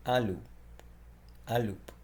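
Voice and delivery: Un loup, loup rhymes with "loo"; French demo. Shown as a demonstration. Teacher modelling Un loup for learners, clear French. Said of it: "you just say un loup".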